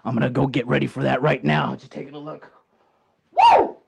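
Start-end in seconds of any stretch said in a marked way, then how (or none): 3.3-3.8 high whooping cheer; excited woo. Acted. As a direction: let out a big woo. Champion cheers.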